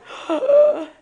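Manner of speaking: sharp high gasp; mock gasp. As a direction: gasp in exasperation. Her reaction to dad's fixing.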